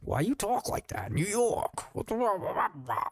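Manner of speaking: high-pitched mock New York accent. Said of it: "a guy with a New York accent".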